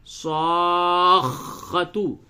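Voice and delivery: صَاخَّةُ with a long, doubled kha, very slow. Shown as a demonstration. Teacher drills the doubled kha for students. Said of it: "دیکھیے دو خا ہے".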